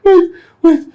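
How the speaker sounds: loud, high words through laughing; helpless laughter. Acted. Friend asking for a moment, still laughing. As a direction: try to speak while cracking up.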